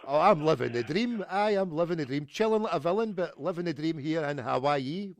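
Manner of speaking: high-pitched, over-friendly phone greeting; excited. Pretend. Prank caller greeting pub staff, excited. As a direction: gush it like an old pal. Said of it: "when I get excited".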